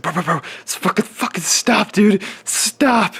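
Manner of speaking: high, loud urgent shouting; frantic yell. Retold or imitated. To the gym friend during filming, frantic high shout. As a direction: yell at him to stop before it wrecks the scene.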